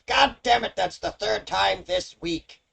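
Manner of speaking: high, loud, weary imp grumbling; a beheaded imp sighing at his bad luck; exasperated imp. Imp whose head just fell, high exasperated grumble. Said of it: "he goes".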